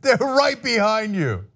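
high, half-playful warning shout; mock scream. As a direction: yell a warning at the screen, hammed up. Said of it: "I wanted to scream".

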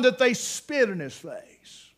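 slow preaching emphasis on each word; slow weighty pulpit statement; slow, emphatic. Acted. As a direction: drive home every word slowly. Preacher stresses the scripture point.

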